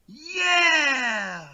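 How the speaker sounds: high, loud, drawn-out whoop; imitated celebratory cheer. Vegan police cheering as they jump up, mock triumphant yell. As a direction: cheer like a victorious high-five. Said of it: "you just hear them in the background going".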